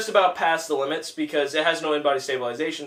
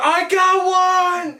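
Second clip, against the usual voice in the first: high, drawn-out triumphant holler; hysterical Leo impression; 13 semitones higher, 5 dB louder, much slower than usual. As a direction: mimic an over-the-top action-movie cry, dragging it out. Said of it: "Everyone just mimicked him and laughed".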